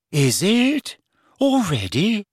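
high, airy and vague; dreamy Luna voice. A narrator's reading. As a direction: drift through it dreamily. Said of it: "said Luna vaguely".

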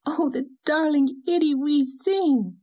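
high, drawn-out gushing coo; adoring sing-song exclamation; exclaimed baby talk. A narrator's reading. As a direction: gush slowly over the baby in a high voice. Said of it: "she had exclaimed".

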